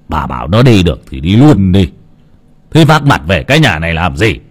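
put-on low, curt old man voice; cold old father. A narrator's reading. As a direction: brush her off coldly as the stern father. Father rejects daughter coldly. (giọng ông bố lạnh lùng, trầm và cộc lốc)

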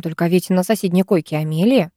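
plain reading voice

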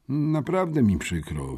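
usual speaking voice

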